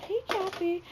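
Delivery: high-pitched mock hello; singsong imitation of a neighborly hello; imitated friendly greeting. Imitates woman greeting old lady.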